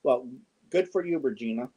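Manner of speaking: ordinary delivery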